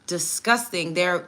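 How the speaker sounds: ordinary talk, nothing marked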